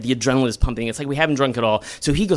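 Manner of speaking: ordinary delivery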